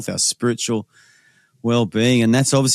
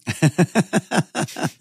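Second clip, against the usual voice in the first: high, rapid run of chuckles; hearty laugh; 5 semitones higher than usual. Real, not acted. Co-host reacting to a farmer's lost sheep story, hearty high laugh.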